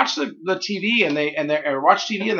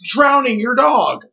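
mock ad disclaimer; 7 semitones higher, 4 dB louder than usual. Parodying drug ad disclaimer.

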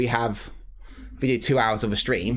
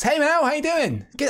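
high bright hello to a friend; cheerful greeting; 3 dB louder than usual. Real, not acted. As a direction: call out a happy welcome. Greets arriving guest.

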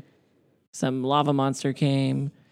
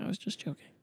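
whispered aside; 4 semitones higher, 12 dB quieter, faster than usual. Real, not acted. Sheepish admission after teasing.

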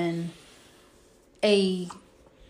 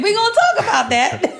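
laughing; 12 semitones higher, 7 dB louder than usual. Real, not acted. Laughing at dark joke.

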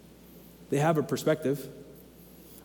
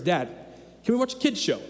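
put-on little toddler voice. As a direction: sweetly ask like a tiny kid. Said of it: "in his little toddler voice".

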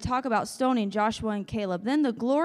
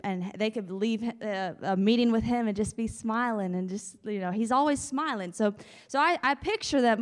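normal talking voice

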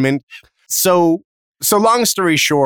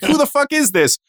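appalled mom; 8 semitones higher than usual. Retold or imitated. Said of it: "I'm like".

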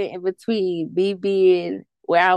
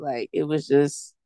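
ordinary talk, nothing marked

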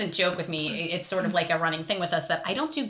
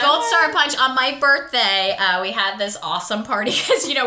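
ordinary talk, nothing marked

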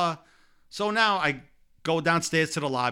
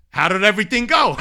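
laughing through a quote; 6 semitones higher, 9 dB louder than usual. Retold or imitated. Quoting Mattingly while laughing.

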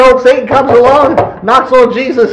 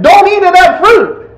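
神の命令; 9 semitones higher, 3 dB louder than usual. Acted. Voices God's command forcefully.

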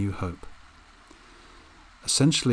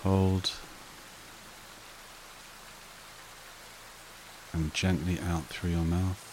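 soothing breathing-exercise delivery, spaced out and unrushed; guided meditation; 7 dB quieter than usual. Acted. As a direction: guide them gently into calm, phrase by unhurried phrase.

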